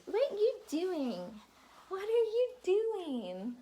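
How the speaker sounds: mom voice. Real, not acted. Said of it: "sorry about the mom voice".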